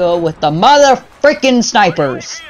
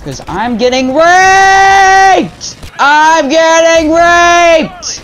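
much higher, louder, slow drawn-out fake scream; high-pitched mock scream; 11 semitones higher, 7 dB louder, much slower than usual. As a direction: scream it long and shrill as announced. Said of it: "very loud, high-pitched ... scream".

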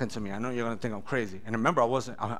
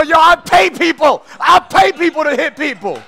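prideful shout; 13 dB louder than usual. Retold or imitated. Dealer boasting to detectives, loud and proud. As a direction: yell it with wounded pride.

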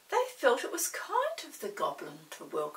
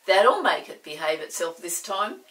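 grinning Chinky; 14 dB louder than usual. Acted. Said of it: "Chinky grinned at the others".

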